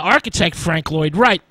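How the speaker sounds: usual speaking voice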